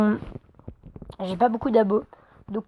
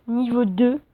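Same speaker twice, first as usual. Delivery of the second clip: ordinary delivery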